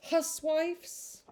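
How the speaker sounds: high, questioning Huswife's; puzzled query. Real, not acted. Reader stopping at an odd word, puzzled. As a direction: repeat the strange word in surprise. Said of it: "What's a huswife".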